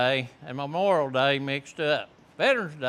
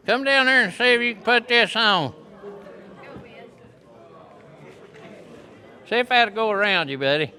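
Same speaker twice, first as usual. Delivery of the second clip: loud, higher call to a student; 7 semitones higher, 5 dB louder, faster than usual. Real, not acted. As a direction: call him down to the front, loud and cheerful. Calling a student to the front.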